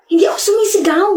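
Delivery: high-pitched, indignant denial. Acted. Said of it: "ba't ka sumisigaw".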